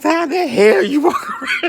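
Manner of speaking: high, loud, slow, laughing disbelief; laughing mock outrage. Retold insult, laughing.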